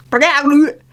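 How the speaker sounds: a sudden squeaky cartoon stumble; Doodle Bob voice. Real, not acted. Stumbling over a word, sounding like Doodle Bob. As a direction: blurt it out like a cartoon scribble. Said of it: "why did that sound like literally Squiggly Bob".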